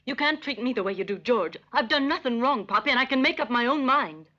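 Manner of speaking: high-pitched, impassioned protest; defiant daughter. Acted. Daughter insists on making up her own mind, defiant protest. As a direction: stand up to a domineering father.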